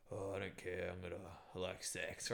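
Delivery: low, soft, dazed murmur; entranced. Retold or imitated. Man in a trance giving in to the woman, entranced. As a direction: imitate someone helplessly under a spell. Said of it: "I was in a trance".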